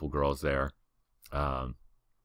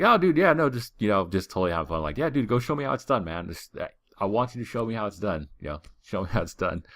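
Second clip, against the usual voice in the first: sarcastic brush-off; 5 semitones higher than usual. Retold or imitated. Brushing off the harasser at the club, mock-cheerful sarcasm. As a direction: egg him on, dripping sarcasm.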